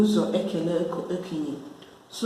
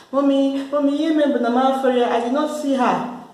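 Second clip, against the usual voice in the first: put-on whiny child excuse, higher; pleading child; 6 semitones higher, 4 dB louder than usual. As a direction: plead with mommy like a caught kid.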